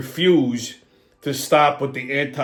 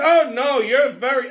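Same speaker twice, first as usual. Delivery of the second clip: higher-pitched tipsy lady impression; lightly mocked old-lady voice, pitched up; 7 semitones higher, 4 dB louder than usual. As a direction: imitate an older woman gushing.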